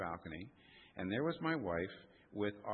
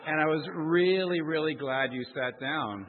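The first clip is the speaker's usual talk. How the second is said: louder, higher, with a laugh; laughing retold; 7 semitones higher, 8 dB louder than usual. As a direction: laugh while quoting the wife. Wife retold, laughing, loud.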